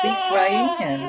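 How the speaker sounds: high sing-song teasing. Pretend. Mother teasing in baby talk.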